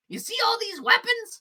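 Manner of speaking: high, loud breezy voice; chatty shopkeeper. Acted. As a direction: rattle it off cheerfully. [name] shows bucket.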